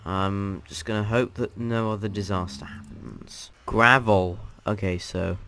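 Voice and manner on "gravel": loud one-word title; announcer call. Real, not acted. Host naming the next dimension for viewers, loud announcement. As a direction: punch out the name loudly.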